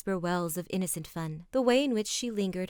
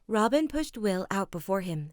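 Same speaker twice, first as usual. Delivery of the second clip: plain reading voice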